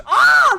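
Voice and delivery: high, loud "ah"; sudden high-pitched shriek; launch scream. Real, not acted. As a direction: scream out as you go flying. Launches in the game.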